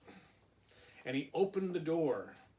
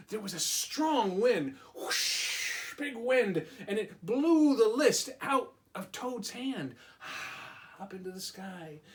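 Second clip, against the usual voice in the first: dramatic narration; 5 semitones higher than usual. A narrator's reading. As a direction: build the wind's drama for the kids. Wind drama in story.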